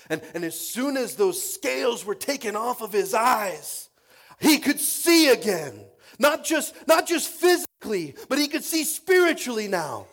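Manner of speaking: impassioned pulpit shouting; full-force preaching. Real, not acted.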